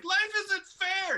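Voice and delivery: put-on high, loud, slow childish wail; mock melodramatic kid despair; wailing daughter. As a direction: wail it like a heartbroken ten-year-old. Daughter's outburst in retold story.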